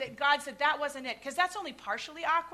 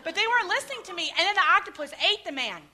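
usual speaking voice

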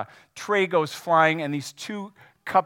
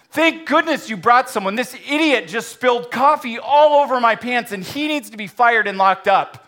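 higher, louder outraged complaint; indignant demand; 9 semitones higher, 10 dB louder than usual. Acted. Customer accusing the waiter to the policeman, indignant. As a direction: demand he be fired and jailed.

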